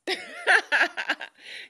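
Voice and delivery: loud, high burst of laughter; big high-pitched laugh. Real, not acted. Laughing at her list of socials.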